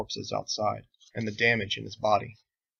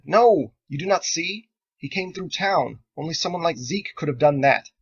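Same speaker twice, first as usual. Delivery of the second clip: urgent insistence; 6 semitones higher, 8 dB louder than usual. Acted. Andelin urgently corrects her crew.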